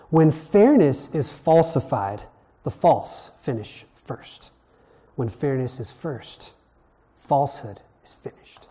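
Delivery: slow, hushed, weighty lines leaning on every f; alliteration demo. Shown as a demonstration. Teacher reciting a made-up Hebrew-style alliteration, slow and solemn. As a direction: let each f land slowly and gravely. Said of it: "Like something like this".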